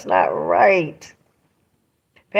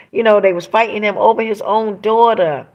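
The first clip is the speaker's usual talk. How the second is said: ordinary delivery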